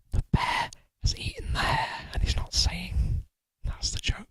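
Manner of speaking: conspiratorial whisper of the twist; whispered reveal. Acted. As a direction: lean in and whisper the secret.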